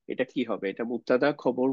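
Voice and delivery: ordinary delivery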